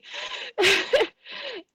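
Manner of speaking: high, sudden tearful outburst; choked cry bursting out; real sob. Real, not acted. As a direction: break into a high, loud sob. Overcome while talking about best friend.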